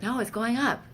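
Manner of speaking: high, insistent denial; insistent protest. Retold or imitated. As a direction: push back hard on the medics. Arguing with paramedics.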